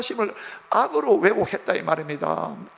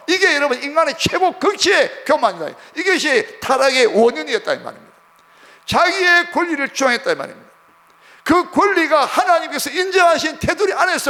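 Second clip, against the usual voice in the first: high, loud sermon delivery; forceful preaching; 7 semitones higher, 6 dB louder than usual. Real, not acted. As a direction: preach it out hard, phrase upon phrase.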